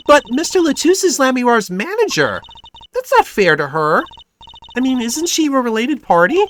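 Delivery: put-on high, animated girl's voice; high-voiced, insistent sidekick; protesting young sidekick Trucy.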